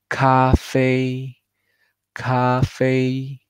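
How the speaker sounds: Café held high and level like a first tone. Shown as a demonstration. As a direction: keep café flat and high. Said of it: "El tono sigue pleno y muy alto".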